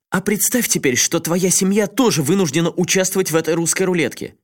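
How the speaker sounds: high, heated pleading rant; worked up. A narrator's reading. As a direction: build the argument into fury. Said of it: "разошёлся не на шутку". (разгорячённо, всё громче)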